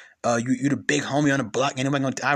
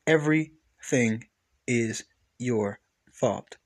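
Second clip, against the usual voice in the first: slow, softer final words; slow and quiet; 4 semitones lower, 3 dB quieter, much slower than usual. Real, not acted. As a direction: drag it out quietly.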